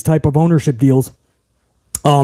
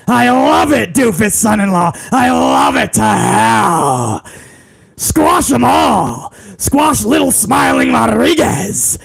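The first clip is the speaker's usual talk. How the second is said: gleeful hammed-up tyrant boss; Vince McMahon. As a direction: relish each order, stretching the key words into a bellow. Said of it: "Vince McMahon was like".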